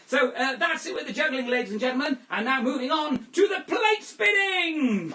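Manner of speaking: loud, high, building announcement; showman announcer. Acted. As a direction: hype the crowd for the next act. Announces next act.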